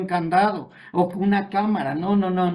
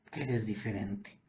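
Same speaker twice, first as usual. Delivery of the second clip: much softer, lower repeat of que eres diferente; soft echo; 8 semitones lower, 12 dB quieter than usual. Acted. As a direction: let the repeat fall to a hush.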